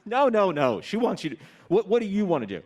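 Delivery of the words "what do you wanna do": ordinary talk, nothing marked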